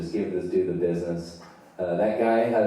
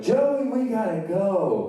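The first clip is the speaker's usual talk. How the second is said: high, drawn-out call through a door; urgent call; 12 semitones higher, 3 dB louder, slower than usual. Acted. Friend banging on the door to get Joey out, urgent call. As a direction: call out high, stretching the words.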